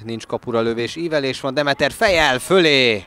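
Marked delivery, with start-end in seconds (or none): 1.5-3.0 excited sports commentary. Real, not acted. Header goes over.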